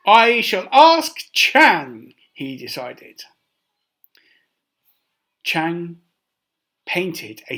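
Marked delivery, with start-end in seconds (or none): 0.0-2.1 emperor voice. Acted. Emperor's voice, loud and slow.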